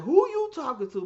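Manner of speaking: loud, high challenge; high, loud confrontational shout; angry shout. Retold or imitated. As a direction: snap it out, ready to fight. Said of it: "And I was like".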